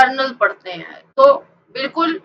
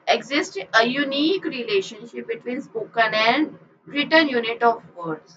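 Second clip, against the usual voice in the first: reading a definition; 3 semitones lower, 7 dB quieter, much slower than usual. Shown as a demonstration. Reads definition slowly.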